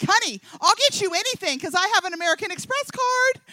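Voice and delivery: high, loud, hammed-up generosity; big excited mock boast; gushing promise. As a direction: promise the world at full excitement. Gushing promise to sponsored child.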